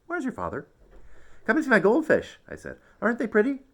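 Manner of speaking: put-on excited kid. A narrator's reading. Child excitedly shows mother.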